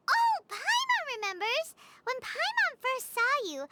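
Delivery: usual speaking voice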